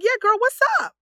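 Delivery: high, loud slangy greeting; code-switched girlfriend greeting. Retold or imitated. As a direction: greet a girlfriend with playful swagger. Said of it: "is that the same person".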